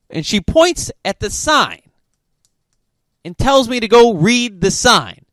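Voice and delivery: ordinary delivery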